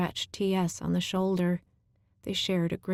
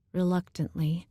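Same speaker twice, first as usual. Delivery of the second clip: usual speaking voice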